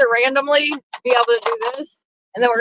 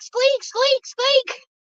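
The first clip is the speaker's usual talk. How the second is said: squeaky chair imitation; 13 semitones higher, much slower than usual.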